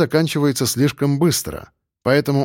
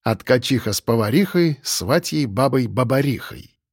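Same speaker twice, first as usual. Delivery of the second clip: ordinary delivery, nothing marked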